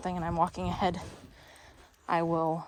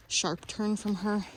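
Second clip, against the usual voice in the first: usual speaking voice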